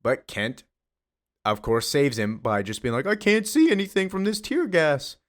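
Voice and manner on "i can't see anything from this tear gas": much higher, hammed-up fake distress; squeaky fake helpless cry; faking blindness. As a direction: pretend you can't see, overplayed. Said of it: "Just faking it".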